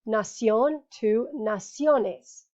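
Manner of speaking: Nación to naciones said slowly, ción stressed first, then ne; teacher slowly contrasting Nación and naciones; slow stress demo. Shown as a demonstration. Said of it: "So now you do not need an accent mark".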